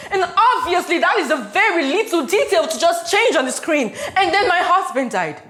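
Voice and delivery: loud, high, agitated venting; frantic rant. Acted. Frantic rant about her life.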